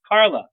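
hitting "Carla." like the punchline of a blunder; stress on Carla. Retold or imitated. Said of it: "I called Meryl Streep Carla".

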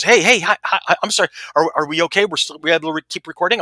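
high, stumbling apologetic greeting; mock-flustered and eager to check; flustered podcaster. Retold flustered podcaster caught mid-sentence.

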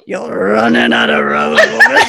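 loud mock growl cracking up at the end. Doc threatens Wynonna, take three, hammed-up growl into laughter. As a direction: growl it big, then lose it laughing. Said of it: "he growls and says".

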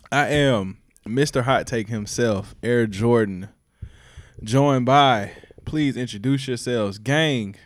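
loud, slow show intro; hype host intro. Acted. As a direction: announce yourself like a host.

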